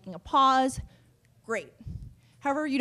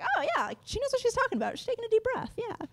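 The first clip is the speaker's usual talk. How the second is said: much higher, softer voice of an impressed listener; 13 semitones higher, 4 dB quieter, faster than usual. Pretend. Speaker voicing an audience member who is impressed by her, in a high soft voice. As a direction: play an audience member who is won over. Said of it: "let other people see and say".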